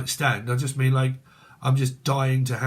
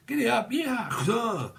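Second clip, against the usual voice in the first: higher, laughing cowboy call; giddy up laugh; 9 semitones higher, slower than usual. Pretend. Reader jokes about riding the donkey, laughing high. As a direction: whoop it up, laughing.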